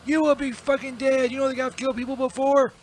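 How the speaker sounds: loud, emphatic yelling; furious death threat. Acted. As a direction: pound out each threat at full voice. Acted death threat at companion.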